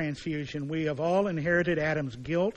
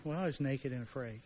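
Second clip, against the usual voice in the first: softer, lower, a touch ashamed; sheepish admission; 3 semitones lower, 9 dB quieter than usual. Acted. Adam answering God from hiding, quiet and sheepish. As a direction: own up quietly like a caught child.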